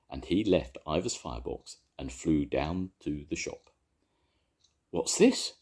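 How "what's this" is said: sudden high alarmed cry; startled, jump up high on it; cried in alarm. A narrator's reading. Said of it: "cried Missus Thomas in alarm".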